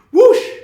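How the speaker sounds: high, loud rushing whoosh; whoosh sound. A narrator's reading. Narrator voicing the elf vanishing with a whoosh. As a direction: blast a magic swoosh.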